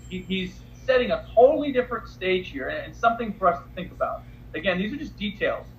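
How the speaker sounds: ordinary talk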